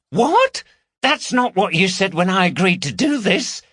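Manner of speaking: high, outraged disbelief; indignant woman. Acted. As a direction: catch him out, shocked. Carol outraged at Gary.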